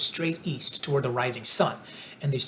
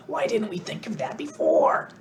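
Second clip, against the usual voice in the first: Scarecrow exclaiming; 5 semitones higher than usual. A narrator's reading. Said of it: "cried the Scarecrow".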